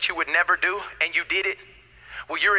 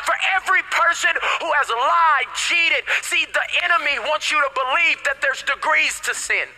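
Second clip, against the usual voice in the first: sermon building higher and more insistent; impassioned preaching; 5 semitones higher than usual. Real, not acted. Preacher offering grace to every sinner, impassioned preaching. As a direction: hammer out each phrase like a revival preacher.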